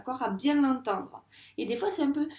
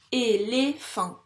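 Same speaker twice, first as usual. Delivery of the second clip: "Éléphant" slower and louder, in three beats; syllable by syllable; 5 dB louder, much slower than usual. Shown as a demonstration. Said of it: "Il y a donc trois syllabes". (« Éléphant » découpé en trois syllabes, bien fort)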